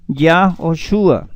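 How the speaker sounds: Yahoshua, slowly, with an O after Yah; pronounced with O. Shown as a demonstration. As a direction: draw out Yahoshua so the O is heard. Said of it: "con O, ¿cierto?".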